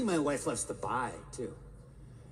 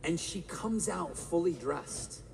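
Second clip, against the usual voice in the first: ordinary talk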